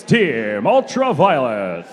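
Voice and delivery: low, slow, drawn-out name reveal; fighting game announcer. Acted. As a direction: stretch the team name for the crowd.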